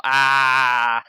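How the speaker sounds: loud, sustained "ah" yell; held open yell, short of a scream; demonstrated yell. Shown as a demonstration. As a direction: show how the yell would go. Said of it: "I was just gonna yell".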